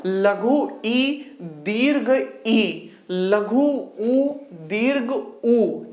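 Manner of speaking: लघु ई, दीर्घ ई, लघु ऊ, दीर्घ ऊ drawn out very slowly, the long vowel held longer; short and long vowels. Shown as a demonstration. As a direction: stretch each दीर्घ vowel well past its लघु partner. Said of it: "इसको बोल सकते हैं दीर्घ आ". (लघु और दीर्घ स्वर धीरे-धीरे खींचकर)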